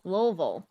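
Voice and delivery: "Louisville," as LOH-vul, middle swallowed; Kentucky-style "Louisville," LUH-vul; swallowed pronunciation. Shown as a demonstration. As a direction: swallow "Louisville," down to two syllables. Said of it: "You swallow half the sentence".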